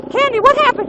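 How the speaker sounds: a mom rushing over in alarm. Retold or imitated. Candy's mom asking her daughter, worried mother voice. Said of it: "she sounds just like my mom".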